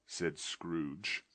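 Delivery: usual speaking voice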